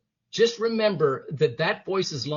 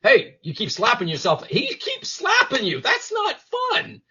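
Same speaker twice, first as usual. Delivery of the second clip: rising outcry; 7 semitones higher than usual. Retold or imitated. Realizing his buddy kept slapping him down, rising high outcry. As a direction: pitch climbing high and slowing at the end. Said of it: "until I realized".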